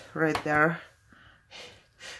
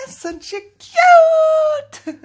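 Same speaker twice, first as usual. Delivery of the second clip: high, slow excited squeal; cutesy squeal; 8 dB louder, much slower than usual. Real, not acted. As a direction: gush over something adorable. Showing off a cute sticker.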